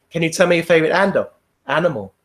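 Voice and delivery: a worn-out examiner on autopilot, fast; bored, rattled-off question. Retold or imitated. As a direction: rattle it off, numb from repetition. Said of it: "the next person would be".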